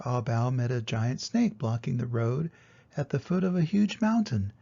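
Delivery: plain reading voice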